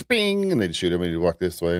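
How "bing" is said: high cartoon ping sound; high mock ding. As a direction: squeak it like a shooting gallery bell. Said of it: "they go".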